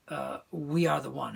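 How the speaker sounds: ordinary delivery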